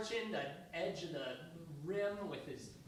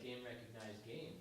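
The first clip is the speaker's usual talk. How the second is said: low cool mutter; 8 semitones lower, 11 dB quieter than usual. Retold or imitated. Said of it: "He's like".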